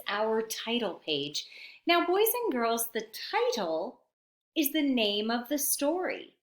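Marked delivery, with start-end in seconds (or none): none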